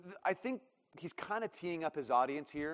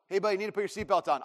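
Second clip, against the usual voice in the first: hurried dad reminder; 3 semitones higher, 3 dB louder, faster than usual. Retold or imitated. Dad repeating seatbelt order.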